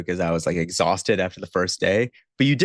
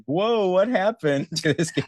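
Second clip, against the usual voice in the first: higher-pitched, put-on bewildered reaction; mock astonishment; 8 semitones higher than usual. Host voicing a returning player's shock at the game, mock astonished. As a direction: play a player reeling in disbelief.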